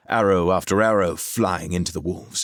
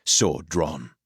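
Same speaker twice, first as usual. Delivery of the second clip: plain reading voice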